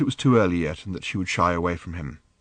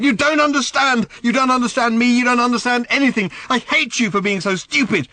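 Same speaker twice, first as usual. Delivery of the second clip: put-on high, loud teenage screaming; furious shouting; 14 semitones higher, 5 dB louder than usual. Daughter shouting at her father that she hates him. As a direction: hurl every word at him like a tantrum.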